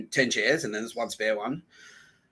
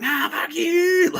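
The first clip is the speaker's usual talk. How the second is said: very high, slow, louder mock shriek; mock tantrum; 6 dB louder, much slower than usual. Ex-fling lashing out at him, mock tantrum shriek. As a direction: drag it out as a squealed mock tantrum. Imitates a woman's furious outburst.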